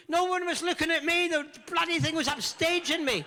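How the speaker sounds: high, loud mock indignation; mock outrage. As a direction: huff like an upstaged diva. Mock jealousy of the upstaging hedgehog.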